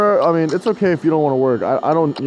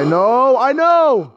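rising, louder annoyed yell; frustrated outburst; 9 semitones higher, 4 dB louder, slower than usual. Real, not acted. Player who just lost, exasperated yell. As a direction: snap back in exasperation.

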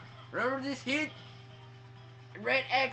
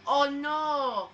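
hammed-up, drawn-out fake terror; mock scared; 3 dB louder, slower than usual. Player taunting the Koopas, mock scared. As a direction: play terrified, sarcastically, stretching every cry. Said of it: "Yeah, right, I'm not scared".

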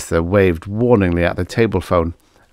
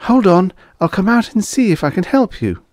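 high female character voice; young woman's voice; 13 semitones higher, 3 dB louder than usual. A narrator's reading. Waitress offers to come out and help, female voice. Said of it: "female voice that answered".

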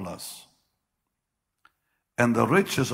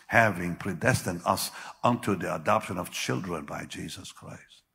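normal talking voice

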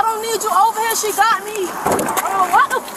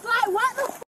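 usual speaking voice

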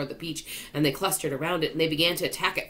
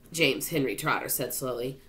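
plain reading voice

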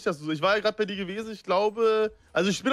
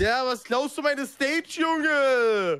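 hammed-up rapper rant; 4 semitones higher, 3 dB louder, much slower than usual. Mock stage quarrel.

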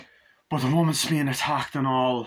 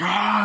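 high, loud imitated battle cry; mock fight yell; 9 semitones higher, 6 dB louder than usual. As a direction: yell as if grappling. Father fighting in retold scene.

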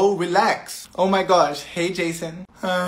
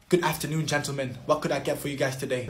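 polite waiter; 6 semitones lower, 12 dB quieter, faster than usual. Acted. Waiter role, low and soft.

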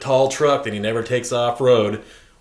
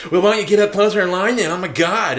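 quick, higher mock of an annoyed woman; snippy retold retort; 7 semitones higher, 3 dB louder than usual. Woman in line scolding him, snippy mimicry. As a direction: mimic her exasperation, fast and raised.

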